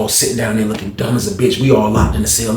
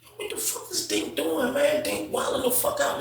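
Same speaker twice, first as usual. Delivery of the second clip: high, slow whispered disbelief; hushed amazed whisper; 13 semitones higher, 12 dB quieter than usual. Retold or imitated. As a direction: whisper like onlookers in shock. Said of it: "So everybody looking like".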